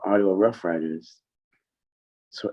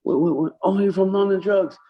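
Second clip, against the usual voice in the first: high, startled exclamation; Kenny reacting to London Drugs, high and fast; imitating Kenny; 11 semitones higher than usual. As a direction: bounce up like a surprised salesman. Kenny voiced.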